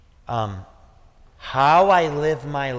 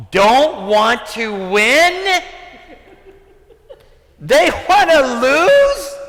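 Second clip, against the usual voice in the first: high, loud, drawn-out incredulous questions; indignant, voice leaping up on each question; outraged disbelief; 9 semitones higher, 5 dB louder than usual. Retold or imitated. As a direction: re-enact confronting the coach in disbelief. Acts out outraged reply to coach.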